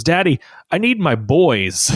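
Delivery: a light imitation of a small child; dad lightly voicing his son; toddler voice.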